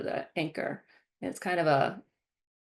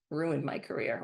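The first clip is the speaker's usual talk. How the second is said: usual speaking voice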